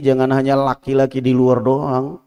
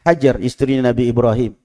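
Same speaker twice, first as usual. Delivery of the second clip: usual speaking voice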